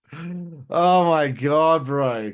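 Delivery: ordinary talk, nothing marked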